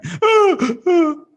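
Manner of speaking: high, loud sobbing gasp; a sudden sob of grief; crying gasp. Acted. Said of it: "he starts crying".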